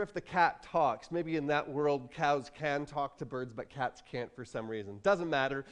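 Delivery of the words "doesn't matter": ordinary talk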